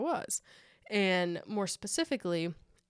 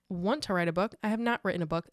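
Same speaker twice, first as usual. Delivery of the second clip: ordinary talk, nothing marked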